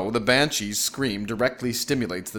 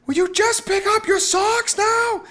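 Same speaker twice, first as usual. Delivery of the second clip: high, slow screamed demand; wife shrieking into husband's ear; 6 dB louder than usual. A narrator's reading. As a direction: scream the nagging question, stretching the words. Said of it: "she screamed into his ear".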